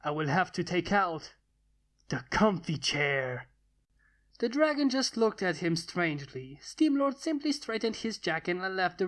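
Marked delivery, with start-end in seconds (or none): 0.0-3.5 a playfully sinister warning, dragged out; mock creepy threat. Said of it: "Steamlord gave out a creepy aura".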